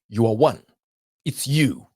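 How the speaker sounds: ordinary talk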